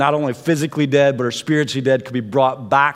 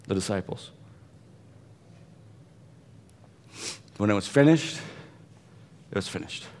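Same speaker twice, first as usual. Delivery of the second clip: hushed; 9 semitones lower, 13 dB quieter, faster than usual. Real, not acted. Preacher hushing his congregation on the finality. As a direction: let the words fall away into silence.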